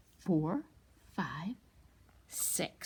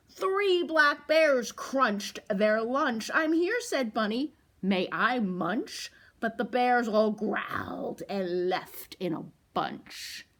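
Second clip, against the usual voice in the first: louder, slow read-aloud; storytime reading; 2 semitones higher, 6 dB louder, slower than usual. A narrator's reading. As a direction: read it slowly and big for the kids. Reads the picture book aloud.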